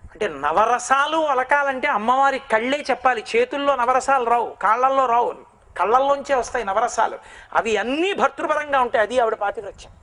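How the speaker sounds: ordinary delivery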